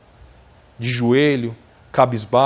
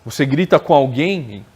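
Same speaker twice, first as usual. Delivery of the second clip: ordinary delivery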